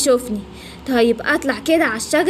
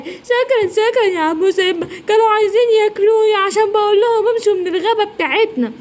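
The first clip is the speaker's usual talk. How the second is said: tearful complaint; 6 semitones higher than usual. Acted. الزرافة تشكو باكية.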